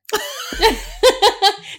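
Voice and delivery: laughing out loud; high laugh. Real, not acted. Both laugh hard.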